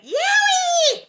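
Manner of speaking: very high, very loud whoop; full-out "yowie" holler; wild catamount screech. Acted. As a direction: screech it like a panther. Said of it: "apparently he went".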